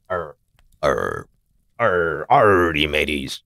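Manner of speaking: low mock pirate growl. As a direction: ham up a pirate, mateys and all. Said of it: "I'm gonna pirate voice".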